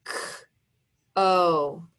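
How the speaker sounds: "K-O" with a long gap between k and oh; tapped-out sounds. Shown as a demonstration. Teacher dictating as pupils write, "K-O" slowly segmented. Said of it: "Tap it out".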